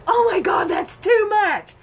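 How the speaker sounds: high, loud, alarmed cry; wife shouting at husband over too much dye, high panicked yell. Retold or imitated. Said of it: "I'm like".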